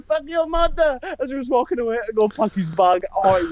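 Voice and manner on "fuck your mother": high-pitched imitation of a cursing friend; mock indignant curse in a raised voice; Levente. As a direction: mimic a parting insult, pitched way up. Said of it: "he was sat there going".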